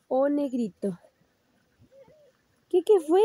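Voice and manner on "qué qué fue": startled. Real, not acted. Startled by the dog arriving.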